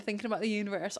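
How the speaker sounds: normal talking voice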